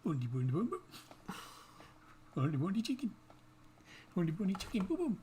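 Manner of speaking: mock Swedish Chef gibberish, sing-song; hammed-up Muppet Swedish chef babble.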